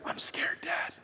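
hushed whisper as a frightened son; imitated scared boy. A son to his dad in the safari car, imitated scared whisper. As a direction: whisper it like a child afraid to be heard. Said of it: "one of our four boys say".

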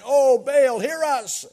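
loud high wail to Baal; a frantic call to a false god; prophets crying out. Acted. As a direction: cry out desperately to your god.

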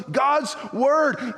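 shouted, high God's word; preacher shout. Acted. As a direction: proclaim it with a shout.

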